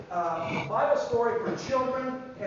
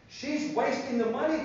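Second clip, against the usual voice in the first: complaining disciples; 4 semitones higher than usual. Retold or imitated. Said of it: "the disciples complained".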